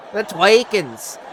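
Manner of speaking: put-on slow drawn-out Minnesota accent on "the twerkings"; mock Minnesota mom. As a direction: stretch "the twerkings" with a Minnesota drawl. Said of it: "like a Minnesota".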